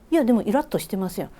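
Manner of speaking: quick Kansai-dialect teasing retort. Retold or imitated. Junior teasing Yoko about her irritation, quick Kansai dialect. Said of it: "めっちゃ関西弁になってしまった".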